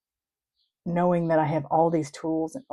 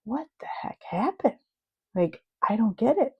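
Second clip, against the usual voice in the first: baffled despair; 6 semitones higher than usual. Acted. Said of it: "you fall into this pit of despair".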